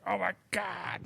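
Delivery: high, hushed retold amazement; softly exaggerated oh-my-God reaction; mock awe. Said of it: "Everybody's like".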